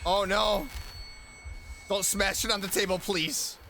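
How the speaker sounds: ordinary talk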